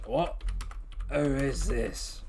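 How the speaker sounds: slow, loud, startled question. Acted. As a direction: sound caught off guard. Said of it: "He goes".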